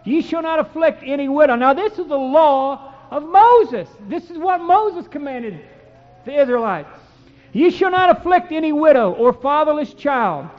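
loud, solemn preaching of the law; fervent scripture reading. Acted. Preacher reading Exodus to his congregation, loud and fervent. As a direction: proclaim each verse like a warning.

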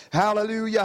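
ordinary delivery

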